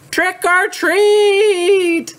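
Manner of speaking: high, long drawn-out yell. Acted. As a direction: holler it, stretching every word. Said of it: "she's gonna yell".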